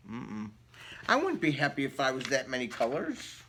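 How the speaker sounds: usual speaking voice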